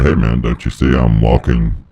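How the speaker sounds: normal talking voice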